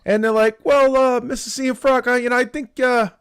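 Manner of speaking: a hint of a hesitant car salesman; salesperson pitch. Acted dealership sales pitch to wife.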